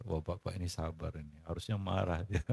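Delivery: low, hushed onlooker's remark; imitated admiring aside. Onlookers remarking on a man holding anger, imitated murmur. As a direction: murmur it low, as bystanders would.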